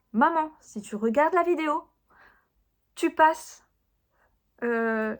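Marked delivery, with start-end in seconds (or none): 0.1-3.7 higher and louder, teasing order; playful warning. Acted. Telling her mum to skip the video, playful loud warning. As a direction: shoo mum away with mock firmness. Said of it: "je mets un warning".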